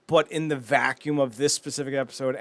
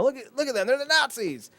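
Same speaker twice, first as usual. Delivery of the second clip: high-pitched imitated alarm; mock accusation; 11 semitones higher than usual. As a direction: point out the culprits, voice pitched up. Acting out students accusing Shirley.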